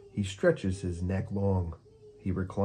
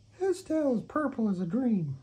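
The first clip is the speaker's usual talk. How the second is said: high, dreamy parakeet; a fluttery bird swooning over Unicorn. Acted. Parakeet character voice.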